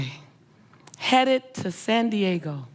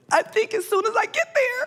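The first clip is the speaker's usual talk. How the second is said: very high, squeaky tearful wail; mock bawling; 14 semitones higher than usual. Speaker answering the regal woman mid ugly cry, mock bawling. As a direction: squeak it out through pretend sobs. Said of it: "I just start bawling".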